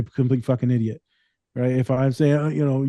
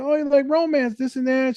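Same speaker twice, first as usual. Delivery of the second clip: high mock teasing; 12 semitones higher than usual. Mocking client about romance books.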